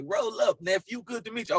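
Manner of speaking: high-pitched, playful rapper imitation. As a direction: imitate a rapper greeting someone, pitched well up. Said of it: "Snoop throws me an ounce, like".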